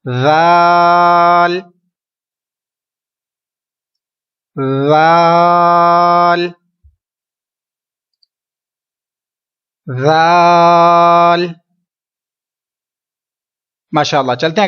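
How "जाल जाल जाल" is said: जाल stretched very long and even; teacher modelling long जाल; drawn-out recitation. Shown as a demonstration. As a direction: hold जाल for three seconds. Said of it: "repeat कीजिए तीन मरतबा".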